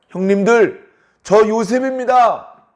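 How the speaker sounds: high, loud, slow tearful cry of recognition. Acted. Joseph revealing himself to his brothers, crying out. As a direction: call out through tears, drawing it out.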